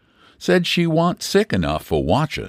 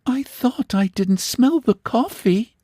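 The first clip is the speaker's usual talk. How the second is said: put-on high, lightly surprised girlish voice; spoiled young woman puzzled by the empty kitchen; innocent young woman; 10 semitones higher, slower than usual. A narrator's reading. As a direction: ask innocently. Luella complaining.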